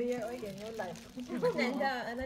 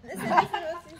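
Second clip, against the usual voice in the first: loud laughing call; 4 semitones higher, 8 dB louder than usual. Real, not acted. Calling for the group's attention.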